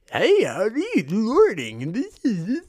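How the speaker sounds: high, bright mock-cheerful hello; chirpy morning greeter. Mocking a too-cheerful person greeting him at six AM. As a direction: parody an overeager coworker at dawn. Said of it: "they still look up to you".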